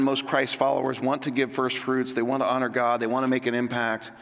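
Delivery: ordinary delivery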